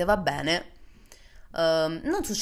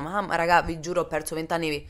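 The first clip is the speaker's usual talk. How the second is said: ordinary talk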